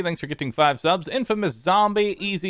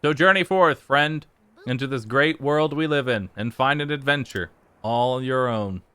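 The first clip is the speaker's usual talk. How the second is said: grand narration. Acted. Reads the closing narration grandly.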